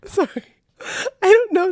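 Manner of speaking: high, squeaky, tearful laughing voice; words squeezed out through helpless laughter; crying laughing, can barely get it out. Real, not acted. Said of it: "I'm sorry, I'm crying".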